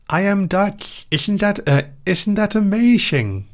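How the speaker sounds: high-pitched, hammed-up Dutch accent; cartoonishly thick Dutch accent; over-exaggerated Dutch accent. Shown as a demonstration. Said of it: "do an over-exaggerated Dutch accent".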